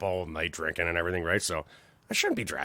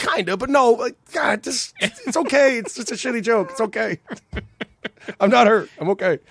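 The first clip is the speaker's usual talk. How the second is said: mock hurt; 12 semitones higher, 8 dB louder than usual.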